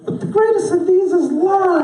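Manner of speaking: sky-high squeaky demo voice; very high, held pitch. Shown as a demonstration. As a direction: say it way up high, drawing out love. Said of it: "say it like this".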